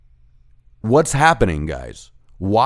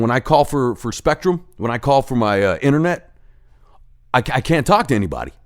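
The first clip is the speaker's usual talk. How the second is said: ordinary talk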